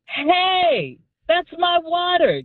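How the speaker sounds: high, sharp call down the street; calling out after the thief; cried out. Retold or imitated. Shouting after the thief down the street, high call. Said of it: "I cried out".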